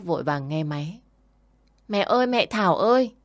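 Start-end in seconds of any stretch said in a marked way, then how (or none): 1.9-3.1 put-on high, eager child's call; child calling mum. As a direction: call out to mum like a small boy. Child calls mother on phone. (bé trai gọi mẹ, giọng cao)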